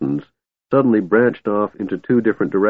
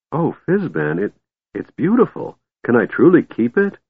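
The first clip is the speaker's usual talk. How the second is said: excited kender; 8 semitones higher than usual. Acted. Tass excited over golden dragon.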